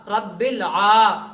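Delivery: slow, syllable by syllable; measured, drawn-out teaching recitation; spelling-out recitation. Shown as a demonstration. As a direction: draw out each syllable for learners. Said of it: "آج میں ہجا کر رہا ہوں".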